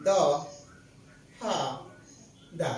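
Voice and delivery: দ, হা, দা with long pauses between; syllable by syllable. Shown as a demonstration. Teacher showing where the brain stops, isolated syllables দ, হা, দা. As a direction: stop fully after দ and after হা. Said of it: "কোন জায়গায় যায় brain থামতেছে".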